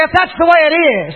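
loud, high preacher's rallying cry; high, forceful call-out; shout. Shown as a demonstration. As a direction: shout it for the congregation to echo. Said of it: "Shout it at me again".

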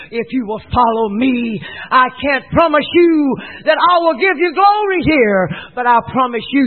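loud, high preacher voicing Christ. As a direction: proclaim the promise with full force.

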